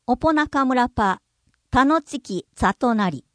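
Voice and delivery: おぽ中村パ with popped P, "opo-naka-mura pa"; Yayoi-language pronunciation. Shown as a demonstration. Narrator demonstrates the example sentence in reconstructed Yayoi language. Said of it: "弥生語".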